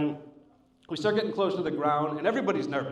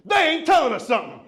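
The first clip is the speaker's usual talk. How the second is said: high, loud outburst from rows back; loud mock-outraged passenger; indignant heckler; 10 semitones higher, 6 dB louder than usual. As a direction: mimic a passenger yelling out suspicions. Suspicious girl calls out on plane.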